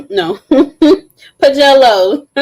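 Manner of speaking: laughs through the name; laughing. Real, not acted.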